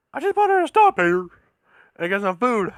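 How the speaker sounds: very high, loud cartoonish character voice; Pee-wee Herman. Actor showing off in Burger King, imitated high comic voice. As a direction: squeal it out in a put-on comic voice. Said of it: "he went to his Pee-wee accent".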